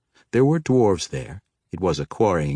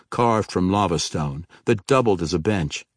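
ordinary delivery, nothing marked

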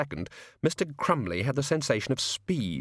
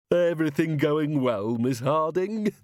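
hearty, drawn-out boss voice, pitched up; jovial store manager; 5 semitones higher, slower than usual. Acted. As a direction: beam it out slowly to the staff. Jovial manager greets his cashier.

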